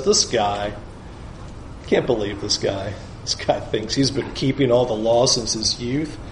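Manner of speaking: low, quiet, scornful mutter; disgusted scoff. Retold or imitated. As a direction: scoff at him under your breath. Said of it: "is disgusted by him".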